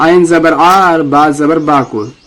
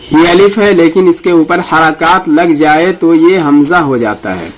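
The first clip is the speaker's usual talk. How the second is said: usual speaking voice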